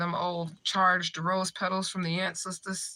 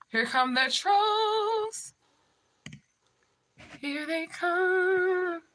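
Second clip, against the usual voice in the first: high, slow sing-song warning; mock announcement; 12 semitones higher, much slower than usual. Warning her stream viewers the trolls are coming, slow sing-song. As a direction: drawl it out like a spooky announcement.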